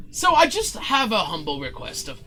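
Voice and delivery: mildly theatrical, self-important request; condescending showman. Acted. Showman asking the opener to keep it short, condescending. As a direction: explain it with a touch of grandeur. Zin makes his request.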